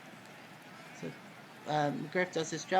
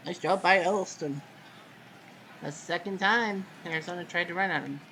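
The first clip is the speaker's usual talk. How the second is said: ordinary talk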